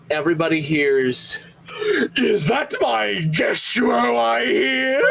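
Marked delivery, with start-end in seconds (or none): 2.2-5.0 higher, slow, calling from afar; booming call. Acted. Gorla calling out from the spiral for Geshuo. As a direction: call out from a distance, overjoyed.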